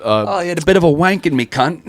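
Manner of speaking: high put-on British accent; hammed-up British impression; mock British lady.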